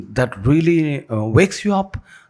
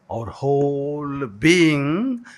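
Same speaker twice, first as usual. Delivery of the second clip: very slow and drawn out; slow recital; much slower than usual. Shown as a demonstration. Speaker modelling the line for the class, very slow. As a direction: stretch each word for the listeners. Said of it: "like this, do".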